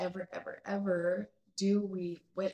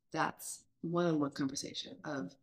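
ordinary talk, nothing marked

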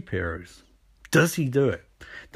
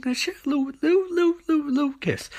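much higher, softer, coy voice on little; mock cutesy; 10 semitones higher, 3 dB quieter, faster than usual. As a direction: tease the little kiss sweetly. Mocking TV romance cliché.

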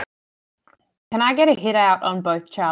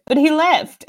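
light, higher-pitched mock quote; 8 semitones higher, 3 dB louder, slower than usual. Reader mocking a favourite line from the book, higher-pitched imitation. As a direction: lift it high to poke fun at the line. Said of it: "when he goes".

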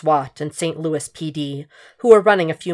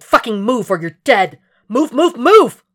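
loud, rising panic shouts; urgent yell; 7 semitones higher, 7 dB louder than usual. A narrator's reading. Inner alarm as the roof caves in, frantic shout. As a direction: bark the order, building to a scream.

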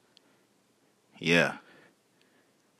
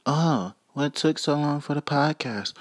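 mock listener voice; 8 semitones higher than usual. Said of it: "as many people may be wondering".

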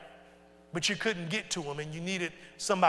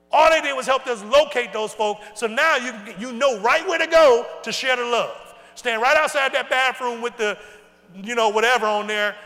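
loud, driving sermon build; louder, higher pulpit fervor; full-force preaching; 6 semitones higher, 10 dB louder than usual. Real, not acted. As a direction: preach it out to the whole room.

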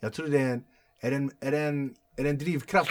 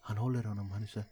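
ordinary talk, nothing marked

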